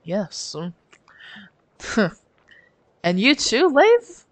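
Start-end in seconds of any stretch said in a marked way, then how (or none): 3.0-4.3 sharp high-pitched tease; high teasing question. Acted. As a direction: toss the name up high.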